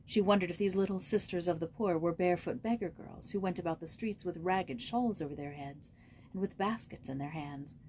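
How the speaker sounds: usual speaking voice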